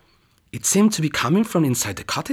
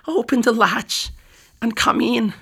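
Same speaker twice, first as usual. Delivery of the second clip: much higher put-on old-lady voice; wolf pretends to be a frail granny; wolf faking Grandma; 10 semitones higher than usual. Wolf in bed fakes Grandma's voice for the girl, high quavery. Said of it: "imitate Grandma's quivery little voice".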